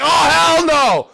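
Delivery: outraged yell. Pretend. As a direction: explode at the insult. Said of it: "Destroyer's like".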